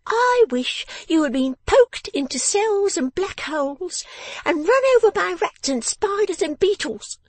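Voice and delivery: high, loud tirade; angry scolding. A narrator's reading. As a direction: wish punishment on him in a high, furious voice. Miss Wren's tirade at her father.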